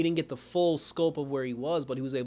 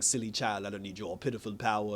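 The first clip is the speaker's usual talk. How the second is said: lower, softer, scornful; scornful villain; 5 semitones lower, 5 dB quieter than usual. Acted. Despero scorning Top's offer, low and contemptuous. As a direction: dismiss her coldly like a villain.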